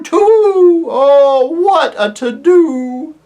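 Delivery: hooting owl aside. Acted. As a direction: owl aside to the children, fretful hoot. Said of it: "in a whisper".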